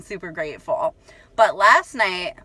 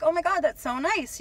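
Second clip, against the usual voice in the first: mock sweet politeness; 8 semitones higher, 3 dB quieter than usual. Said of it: "and I was like".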